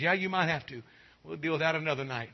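ordinary delivery

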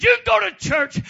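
high, loud order; commanding master. Retold or imitated. Master answering the slave, loud commanding.

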